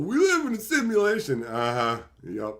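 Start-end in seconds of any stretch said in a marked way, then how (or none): 0.0-1.4 raised-pitch mock believer; ridiculing someone's big theory; mocking quote. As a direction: parrot it in a higher mocking voice.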